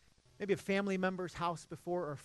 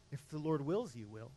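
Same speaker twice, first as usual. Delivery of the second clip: softer, low and measured reply; quiet warning; 5 semitones lower, 7 dB quieter than usual. Retold or imitated. James answering the boastful planners, quiet and sober. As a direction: let it drop to a sober hush.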